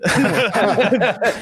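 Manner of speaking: a burst of high happy laughter; delighted high laugh. Real, not acted. Comic recalling a bit that aired, delighted laughing. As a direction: laugh out, tickled by the memory.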